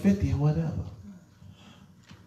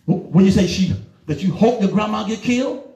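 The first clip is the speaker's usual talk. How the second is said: ordinary talk, nothing marked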